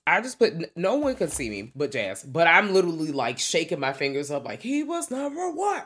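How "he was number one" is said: high-pitched excited quote; mock exclamation. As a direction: put exclamation points on it, voice pitched way up. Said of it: "these are my exclamation points".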